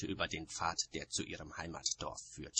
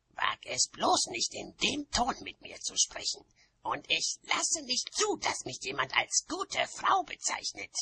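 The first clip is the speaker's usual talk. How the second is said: high, forceful warning; high-pitched indignant threat; outraged witch; 4 dB louder than usual. A narrator's reading. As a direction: warn them off in a high, strained voice. Outraged witch warns the other.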